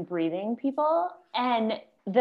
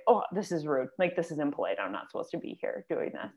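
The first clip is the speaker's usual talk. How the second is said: low, fading self-reproach; self-conscious murmur; 5 semitones lower, 6 dB quieter, faster than usual. Retold or imitated. Voicing a yawner who feels impolite, sheepish murmur. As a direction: sink lower and softer, as if ashamed.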